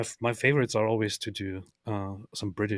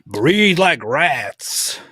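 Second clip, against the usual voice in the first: drawn out slow and much louder, sneering; mocking repeat; 5 semitones higher, 10 dB louder, much slower than usual. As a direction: stretch every word to mock it. Mockingly repeats tweet phrase.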